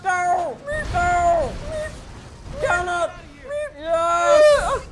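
high loud shout of victory; triumphant cheer. Real, not acted. Gamer cheering a stunt, triumphant shout. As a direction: yell it in triumph.

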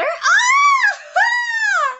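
loud, very high drawn-out shriek; acted scream. Villain revealing a face to the hero, screaming. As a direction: let out a long, high scream.

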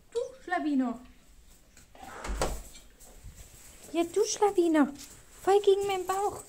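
high loud playful scolding. Real, not acted. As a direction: tease the dog, mock outraged.

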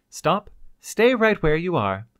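commanding. Acted. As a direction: freeze them in place. Scientist commands the couple.

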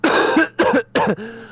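rising harsh cough; a sudden coughing fit. Real, not acted. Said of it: "I have a bit of a cough".

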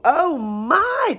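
high-pitched startled exclamation; startled cry. A narrator's reading. Old woman frightened by the elephant, crying out. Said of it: "cried the tiny old woman".

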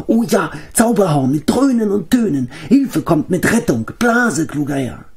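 very high, eager creature voice; Gogi. A narrator's reading. Small companion begs his master to blow the horn, squeaky and eager. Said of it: "flüsterte Gogi".

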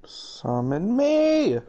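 long, high swoop up on "me"; drawn-out call. Real, not acted. Player begging teammates to summon them, drawn-out call. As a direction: call out slowly, pitch soaring at the end.

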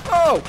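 loud, high "oh" yelp; sudden sharp cry of surprise; startled yelp. Real, not acted. Player caught out in the game, startled.

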